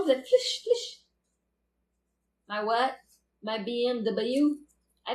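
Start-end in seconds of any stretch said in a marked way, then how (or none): 0.0-1.0 "flush" said as a sudden high squeak; flush sound. Shown as a demonstration. Telling a friend about a sound she blurted, high squeaked "flush". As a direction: squeak "flush" out like it just escaped. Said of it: "il y avait le son".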